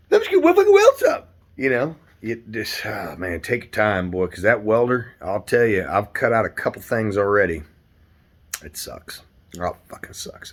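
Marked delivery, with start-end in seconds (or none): 0.1-1.3 very high squeaky mock voice; high-pitched goofy put-on voice; mock squeal. Mock voice of welder. 8.6-10.5 muttered under the breath, much softer; breathy whisper of disgust; whispered grumble. Real, not acted.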